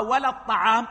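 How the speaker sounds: ordinary delivery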